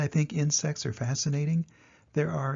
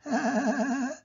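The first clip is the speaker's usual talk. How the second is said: high giggle; 11 semitones higher than usual. Acted. After the scramble.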